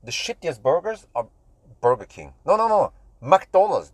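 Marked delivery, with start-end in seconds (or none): 2.5-3.9 high, loud, urgent correction; emphatic self-correction. Real, not acted. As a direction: cut yourself off with a loud, high objection. Abruptly corrects his own burger ranking. (emphatic self-correction)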